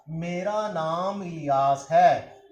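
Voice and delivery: each word drawn out and spaced; slow model answer. Shown as a demonstration. Teacher to students, modelling the answer very slowly. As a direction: spell it out slowly for learners, said three times. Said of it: "तो मैंने ये जवाब दिया था".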